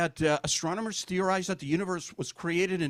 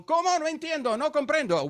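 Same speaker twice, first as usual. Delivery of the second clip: put-on Spanish accent; 9 semitones higher than usual. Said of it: "I go".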